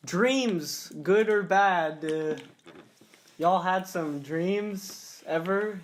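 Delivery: high, loud, slow show-host announcement; drawn-out presenter voice introducing a topic. Shown as a demonstration. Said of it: "All right, moving on".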